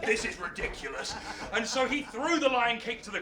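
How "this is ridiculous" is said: sudden drop to a low, softer voice; deadpan protest. Acted. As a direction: deflate the excitement with a low aside. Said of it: "Hercules said".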